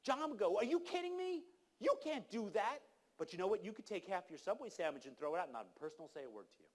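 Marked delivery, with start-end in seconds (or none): 0.6-2.8 high-pitched, indignant imitation of an angry bystander; mock angry outrage. John objecting to gas poured down the drain, mock angry. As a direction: act out someone outraged, pitch shooting way up. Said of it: "people would get really angry".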